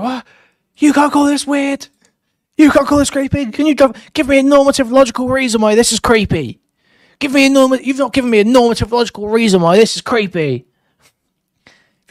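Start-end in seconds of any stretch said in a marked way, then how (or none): 0.0-10.7 high-pitched, loud imitation of a man losing it; mock freak-out. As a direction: spurg out indignantly, much higher and louder. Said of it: "then he spurgs the fuck out".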